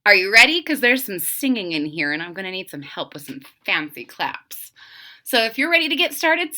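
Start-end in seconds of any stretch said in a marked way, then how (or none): none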